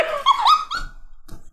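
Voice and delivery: rising squeal of laughter; high disbelieving laugh. Real, not acted.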